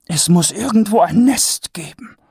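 high lady's voice, half to herself; musing aloud in wonder; Lady Ramkin, lightly murmuring; murmured. A narrator's reading. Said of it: "murmelte sie".

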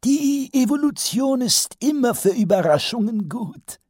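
cheerful. A narrator's reading. Wizard cheerfully answers the council, high and bright. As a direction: chirp it happily like a pleased professor. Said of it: "antwortete der Zauberer fröhlich".

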